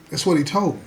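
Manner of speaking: ordinary talk, nothing marked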